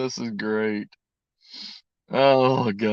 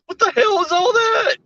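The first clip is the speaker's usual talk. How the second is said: high, startled yell of disbelief; shocked shout. Real, not acted. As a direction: shriek it in disbelief. Shocked at the dessert.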